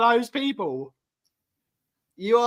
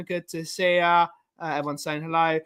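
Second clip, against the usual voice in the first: ordinary delivery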